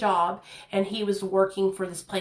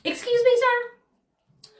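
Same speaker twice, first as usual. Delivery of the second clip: high, startled excuse me; high-pitched offended surprise; shocked disbelief; 13 semitones higher, 3 dB louder, much slower than usual. Retold or imitated. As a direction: recoil as if insulted. Stunned by a rude question.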